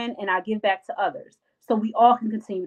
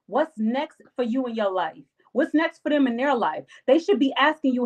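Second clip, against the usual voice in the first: ordinary talk, nothing marked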